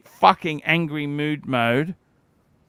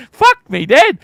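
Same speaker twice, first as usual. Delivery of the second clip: amazed exclamation; 9 semitones higher, 8 dB louder than usual. Real, not acted. Ogling the screen.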